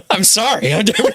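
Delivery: ordinary talk, nothing marked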